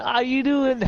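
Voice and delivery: high, eager greeting; excited. Acted. Redneck hugging Sun.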